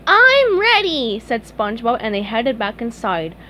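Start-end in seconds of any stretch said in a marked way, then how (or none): 0.1-1.2 high, loud, drawn-out readiness; eager cheer. Acted. SpongeBob agrees to another ice cream, eager. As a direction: stretch it out, raring to go.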